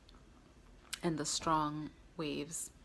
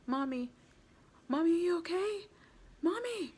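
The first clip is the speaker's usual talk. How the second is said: much higher, louder, panicked screaming of "Mommy!"; panicked yelling; 12 semitones higher, 6 dB louder than usual. Retold or imitated. Son sees mom struggling in waves, panicked screaming. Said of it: "I saw Joshua's panic".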